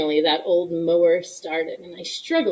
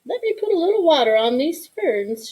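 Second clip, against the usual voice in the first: grandma's voice; 6 semitones higher than usual. Acted. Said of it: "she said".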